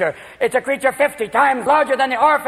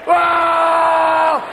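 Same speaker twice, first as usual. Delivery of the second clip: loud, higher plea; screaming wife; 5 semitones higher, 3 dB louder, much slower than usual. Retold or imitated. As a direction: wail it out in panic.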